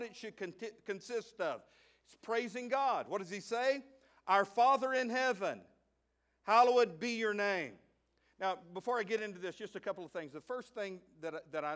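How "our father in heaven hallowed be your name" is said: louder, ringing prayer line; loud preached opening of the prayer. Acted. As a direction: proclaim it to the congregation. Pastor proclaims the Lord's Prayer.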